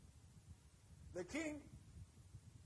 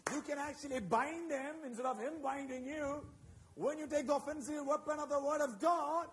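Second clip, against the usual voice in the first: preaching at full force; 5 dB louder than usual. Acted. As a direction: hammer it home to the church.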